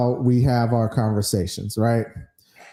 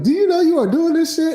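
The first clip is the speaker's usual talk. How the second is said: high-pitched incredulous accusation; put-on high-voiced confrontation; mock indignation; 13 semitones higher, 3 dB louder than usual. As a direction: squeak it out as comic outrage. Retold confrontation on waking spouse.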